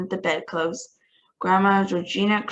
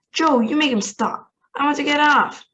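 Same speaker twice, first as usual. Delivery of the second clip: loud, higher pleading shout; frightened yelling; 7 semitones higher, 5 dB louder than usual. A narrator's reading. Grandmother begging Joe to stop Wonka, loud and pleading. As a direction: beg him over the roaring wind.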